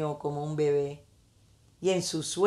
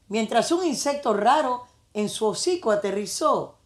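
ordinary delivery, nothing marked

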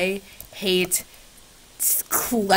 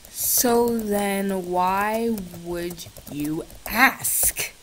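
very slow flat repeat; slow, exasperated deadpan; 4 dB quieter, much slower than usual. Real, not acted. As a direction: spell it out slowly, done with her.

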